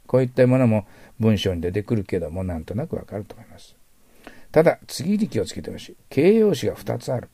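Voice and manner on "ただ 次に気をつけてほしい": ordinary talk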